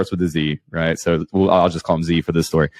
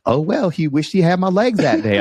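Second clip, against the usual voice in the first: high Mister Herbert-style comic voice; 10 semitones higher than usual. Acted. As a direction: imitate a buddy's breezy shrug in high pitch. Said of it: "you make Ben sound like Mister Herbert".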